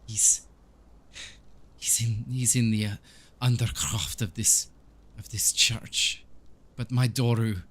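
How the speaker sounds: put-on halting, accented priest in grief; grieving Donovich. Priest revealing his son is lost, grieving and halting. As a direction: push through broken phrases, sinking at the end. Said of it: "He says".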